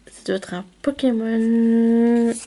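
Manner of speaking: usual speaking voice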